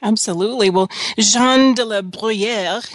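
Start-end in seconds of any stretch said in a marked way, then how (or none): 1.2-2.8 slow, drawn-out French accent. Shown as a demonstration. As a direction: say the name with a playful French accent. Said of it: "that's my French accent".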